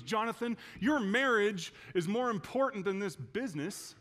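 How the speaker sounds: imitated dad setting his son straight; stern father. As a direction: lay down the law to your son. Acts out dad's stern correction.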